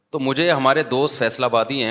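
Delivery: ordinary delivery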